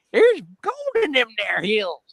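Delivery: high, loud grizzled prospector; old prospector voice. Retold or imitated. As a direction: holler it like an old gold miner. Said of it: "I could go into an old prospector's voice going".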